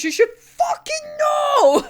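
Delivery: high, slow, exasperated cry; a high, drawn-out cry of frustration; exasperated yell. Real, not acted. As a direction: cry it out, fed up. Exasperated at character.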